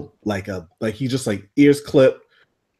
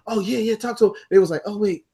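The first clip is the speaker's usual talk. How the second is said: higher mock onlookers egging on; mock cheering onlookers; 8 semitones higher, 3 dB quieter, faster than usual.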